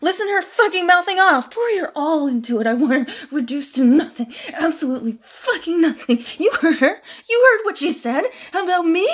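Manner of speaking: loud, worked-up rant; furious ordering. Acted. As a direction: rage at her and demand punishment. Enraged villain ordering a killing.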